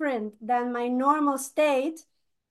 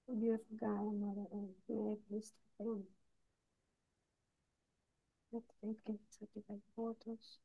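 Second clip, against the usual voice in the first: low murmured prayer, fading away; hushed invocation; 6 semitones lower, 19 dB quieter than usual. Real, not acted. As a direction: murmur softly to Mother Earth. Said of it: "give me like ten, twenty seconds to do that".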